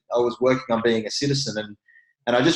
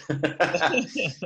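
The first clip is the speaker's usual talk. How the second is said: soft laugh; 5 semitones higher, 4 dB quieter than usual. Real, not acted. Laughs again.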